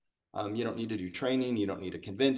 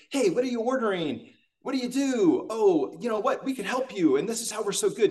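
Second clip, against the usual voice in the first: much higher, keen, salesy rush; mock eager sales pitch; 8 semitones higher than usual. Sales guy pouncing on a stranger at the coffee bar, much higher and eager. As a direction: jump straight into the hard sell with gusto. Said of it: "and he's super excited".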